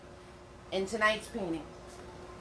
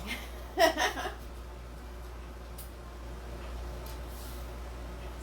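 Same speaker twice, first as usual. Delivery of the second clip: laughing; 6 semitones higher, 6 dB quieter than usual. Real, not acted. Laughs after wordy explanation.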